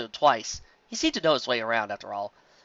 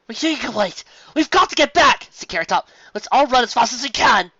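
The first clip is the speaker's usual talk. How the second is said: higher, louder rush; urgent; 10 semitones higher, 7 dB louder than usual. Acted. Carrot Top urges all to run, higher. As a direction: push everyone to run.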